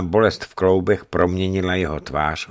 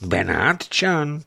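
"Benátčan?" drawn out very slow with a rising, higher pitch; astonished echo; 6 semitones higher, much slower than usual. A narrator's reading. Governor repeats the prisoner's answer in astonishment. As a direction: echo the word in disbelief. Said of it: "podivil se posadník".

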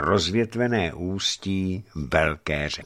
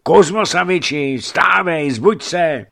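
loud, high call to wake the master; waking call; 9 semitones higher, 8 dB louder than usual. Acted. Messenger shakes sleeping Kuzma awake, loud high call. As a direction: shout him out of sleep.

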